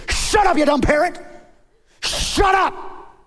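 high, loud scolding yell; angry shout. Acted. Manager grabs the parrot and scolds it, shouting. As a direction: yell it in an acted fury.

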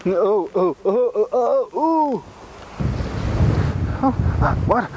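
rising high squeaks; squeaky ventriloquist dummy. Acted. Puppet squeaking exclamations.

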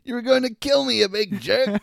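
high, squeaky outraged bug voice; mock high bug voice; indignant fly. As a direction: scold him like an offended tiny fly. Fly scolds Rocko in recap.

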